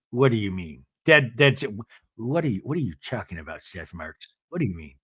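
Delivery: low, quiet mock disbelief; Meredith, incredulous. Meredith questioning her husband, low quiet disbelief. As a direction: mimic a displeased wife, dropped low and soft. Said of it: "And Meredith goes".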